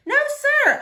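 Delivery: high, loud eager answer; eager reassurance. Acted. Girl reassuring Otis, high and eager. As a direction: reassure him quickly.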